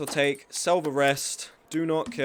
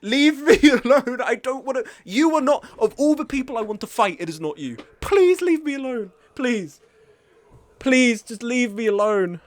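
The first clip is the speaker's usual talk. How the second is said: higher, loud exasperated begging; frustrated pleading; 7 semitones higher, 5 dB louder than usual. Real, not acted. Streamer begging enemy players to leave him alone, loud and higher. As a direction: beg them to go away.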